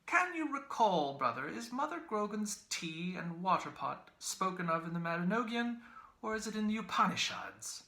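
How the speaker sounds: high, mock-puzzled query with lifted brows; fine, puzzled voice. A narrator's reading. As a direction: ask it in fine, bemused wonder. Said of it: "in a fine, puzzled voice".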